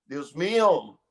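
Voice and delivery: mild retold cry of despair; desperate cry. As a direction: call out to God, despairing. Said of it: "gritaba desesperado".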